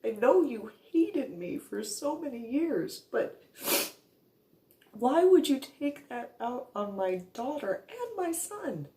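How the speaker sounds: pleading through hurt; tearful plea. Retold or imitated. Confronting mother, tearful.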